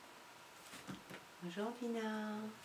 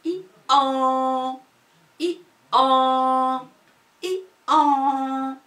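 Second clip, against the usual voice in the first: i an brayed louder and higher; donkey bray; 5 semitones higher, 7 dB louder, much slower than usual. Shown as a demonstration. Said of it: "Il fait".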